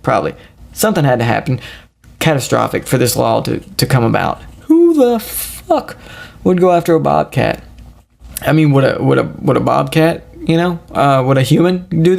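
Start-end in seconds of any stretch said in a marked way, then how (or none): 4.6-7.6 incredulous. Acted. Incredulous at the bobcat law.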